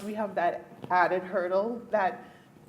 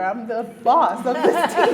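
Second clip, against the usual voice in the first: laughing; 3 semitones higher, 9 dB louder than usual. Real, not acted. Laughing at own claim.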